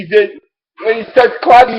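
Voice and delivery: normal talking voice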